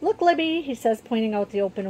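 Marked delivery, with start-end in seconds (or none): 0.0-0.6 put-on higher, eager boy's voice; lightly voiced young boy; excited little brother. A narrator's reading. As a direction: point it out brightly like a small boy.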